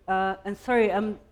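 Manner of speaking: usual speaking voice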